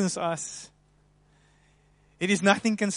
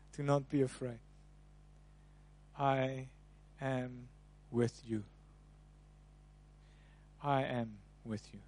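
tender reassurance; 5 semitones lower, 13 dB quieter than usual. Acted. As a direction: speak God's comfort gently, pausing between words. God's voice spoken to listeners.

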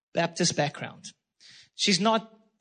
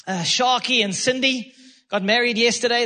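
ordinary talk

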